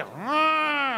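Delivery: high hammed-up madman yell; play-acted crazy shout; David feigning madness with a scream; mock scream. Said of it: "דוד עושה".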